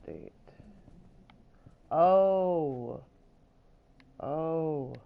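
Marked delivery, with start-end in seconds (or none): 1.9-4.9 surprised. Real, not acted. Sudden find, loud gasp.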